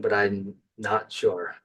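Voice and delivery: usual speaking voice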